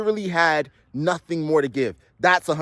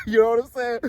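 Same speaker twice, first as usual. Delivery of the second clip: higher, with a laugh; laughing re-take; 6 semitones higher than usual. Shown as a demonstration. Re-adding his catchphrase to viewers, laughing. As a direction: toss the catchphrase back with a chuckle. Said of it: "You gotta add that".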